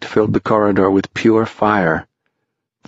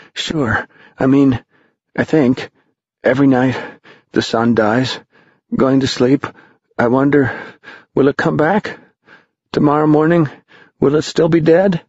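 put-on higher, wondering boy voice; young boy voice; 6 semitones higher than usual. A narrator's reading. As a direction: think aloud like a curious boy. Tom's thoughts voiced while running.

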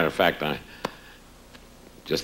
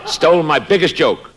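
outraged; 12 semitones higher, 8 dB louder than usual. Acted. Comedian outraged at stolen joke.

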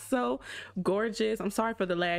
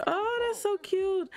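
high squeal of delight; cooing; 12 semitones higher, slower than usual. Real, not acted. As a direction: gush over how cute she is. Cooing at the cute Sim.

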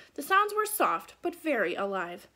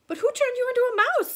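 higher, louder and fast; excited question; 13 semitones higher, 7 dB louder, faster than usual. Acted. Annie asking Morgan who turned her into a mouse, excited and high.

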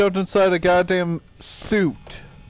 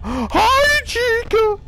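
startled greeting; much slower than usual. Real, not acted. Player startled greeting Chica at the door. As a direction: greet her in terrified surprise.

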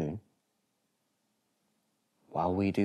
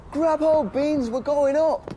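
much higher, louder thrilled shout; excited cry; 7 dB louder, slower than usual. Acted. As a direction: call to the dog as you lift off. Zoe flying off.

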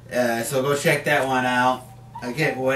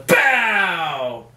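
imitated blast; 4 semitones higher than usual. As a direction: boom out a blast sound effect. Acting out the Punisher's blast.

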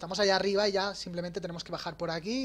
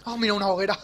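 excited; 5 semitones higher, 6 dB louder, faster than usual. Real, not acted. Finds a bonfire, excited.

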